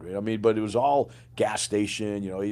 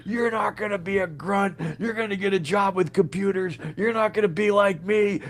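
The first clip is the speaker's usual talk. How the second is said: mock yell, high and insistent; half-hearted impression of a dad lecturing his son; retold scream; 8 semitones higher than usual. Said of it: "my father's screaming at me".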